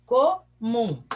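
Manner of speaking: "Comum" split into co and mum, with a gap; syllable by syllable. Shown as a demonstration. Teacher helps pupils count syllables, "Comum" said in two separate parts.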